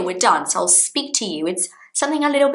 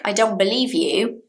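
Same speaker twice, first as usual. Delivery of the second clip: ordinary talk, nothing marked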